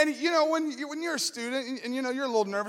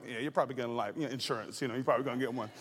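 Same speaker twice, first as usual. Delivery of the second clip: quiet reassurance; 11 semitones lower, 7 dB quieter, much faster than usual. Retold or imitated. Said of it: "and I was like".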